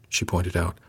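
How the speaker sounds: ordinary delivery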